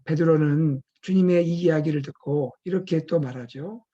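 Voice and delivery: ordinary delivery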